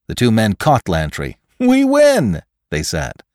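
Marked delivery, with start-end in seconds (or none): 1.6-2.4 very high, loud cheer; triumphant. Acted. Two men catch their quarry, triumphant cheer. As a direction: crow it out high.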